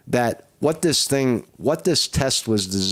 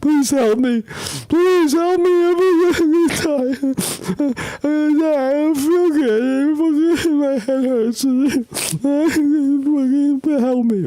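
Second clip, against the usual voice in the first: high, loud, whiny begging to the doctor; mock sick patient; 4 dB louder than usual. Vlogger mocking a sick patient to the doctor, high whiny wail. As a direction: ham up a dying patient pleading. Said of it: "I should go to a doctor now and say".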